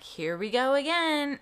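fed up, bracing for another round; exasperated groan. Retold or imitated. New mom to nurse, fed up and loud. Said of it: "And I'm like".

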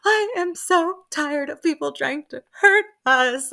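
playing a hurt, fed-up Meredith; upset Meredith. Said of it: "Meredith gets so upset".

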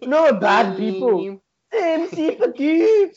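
put-on high, slow, laughing Jamaican accent; Scouse sliding into Jamaican. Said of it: "it's going into Jamaican now".

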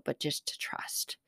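soft, slow near-whisper; hushed. Real, not acted. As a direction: drop to a slow, earnest hush. Hushed close of a faith point.

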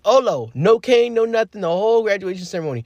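proud excitement. Real, not acted. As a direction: brag it like the win still thrills you. Proud of walking at graduation.

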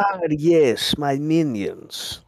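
high, drawn-out cartoon villain accent; hammed-up villain, stretched last word; Gru. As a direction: greet your henchmen in a mock Eastern European accent. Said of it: "uh, Gru".

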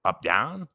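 "up, down" with the pitch swung on each word; tone contour. Shown as a demonstration. Demonstrating the third Mandarin tone, bending pitch. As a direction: trace the bend of the tone on "up, down". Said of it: "third is kinda like".

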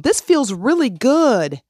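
a sing-song pretend affirmation she doesn't believe; mock forced cheer. To the listener, mocking empty affirmations: slow, high forced cheer. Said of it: "banging my head against a brick wall going".

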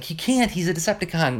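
high-pitched mock objection; retold protest. Other Autobots object to Optimus's plan.

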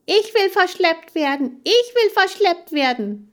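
very high, loud yelling; bellowing. A narrator's reading. Hamster Chan begs to be taken along, bellowing. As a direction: bawl it out, held on one high note. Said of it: "brüllte Chan".

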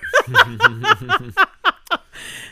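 high, loud, rolling belly laugh; hearty laugh. Real, not acted. Storyteller amused by her own renovation analogy, long loud laugh. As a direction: crack up loudly and keep it going.

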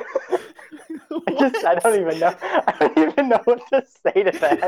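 words broken by real laughter; cracking up mid-sentence; laughing. Real, not acted. Laughing at a joke.

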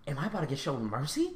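very soft, low wondering; a small, hushed private thought; hushed hope. Retold or imitated. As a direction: wonder it under your voice, cautiously hopeful. Kid's private hopeful thought.

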